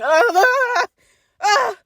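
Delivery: high, loud panicked ah; panicked yelp. Retold or imitated. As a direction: cry out in fright, half laughing. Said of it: "I was like".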